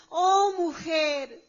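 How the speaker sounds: slow, loud, tearful call full of mercy; drawn-out, compassionate cry of "Oh, mujer". Acted. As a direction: say it like a tender, weeping plea. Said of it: "Le está diciendo esa palabra con misericordia".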